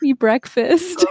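slow, drawn-out playful begging; lightly hammed-up plea; mock pleading daughter. Daughter begging her mom for breakfast, mock pleading. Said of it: "And I'm like".

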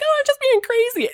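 very high girlish mock voice; imitated carefree girl. Girl excusing her antics to her driver, imitated carefree. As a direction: play her brushing it off in a squeaky-high voice.

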